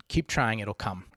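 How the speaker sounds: ordinary talk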